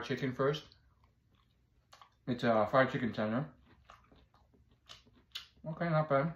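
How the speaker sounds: talking while chewing food; muffled by food in mouth; mouth full, chewing. Real, not acted. Seen 0: Bites chicken at -2s; jaw moving, head down while talking.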